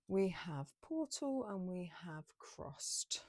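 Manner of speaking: slow, hushed whisper naming the cards. Real, not acted. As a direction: breathe the card names out slowly. Whispered card reveal in reading.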